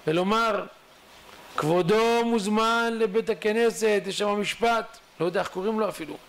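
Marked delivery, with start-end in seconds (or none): 1.6-5.0 raised, stretched-out official call; formal summons. Acted. Beadle sent to the fresh grave, formally summoning the dead. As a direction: call out the summons slowly and higher.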